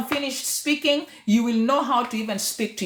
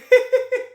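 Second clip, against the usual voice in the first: high, louder burst of real laughter; laughing; 11 semitones higher, 5 dB louder than usual. Real, not acted. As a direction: crack up helplessly. Laughs at the retold comment.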